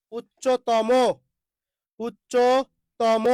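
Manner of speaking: উচ্চতম drawn out as "uch-cho... to-mo"; slow syllabic demonstration. Shown as a demonstration. Teacher showing where the o-kar goes, উচ্চতম slow and split. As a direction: say উচ্চতম very slowly with full o sounds. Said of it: "যুক্ত বর্ণ ভাঙলেন, ওকার দিলেন".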